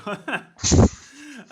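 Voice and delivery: loud, slow movie-trailer announcer; dramatic trailer voice. Acted. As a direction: boom it like a film trailer. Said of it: "I have this, uh, Gallo humor".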